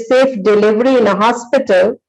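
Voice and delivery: ordinary talk, nothing marked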